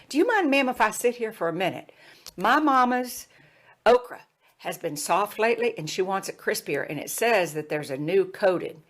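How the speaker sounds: high, polite Southern pleading; Jim Hickson, Southern accent. Retold or imitated. Student asks to sit and read for his mama, Southern accent. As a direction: imitate a courteous Southern mama's boy. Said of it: "he had this Southern accent".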